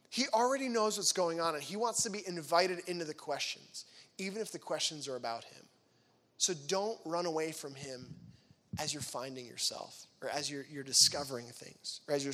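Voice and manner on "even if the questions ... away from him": normal talking voice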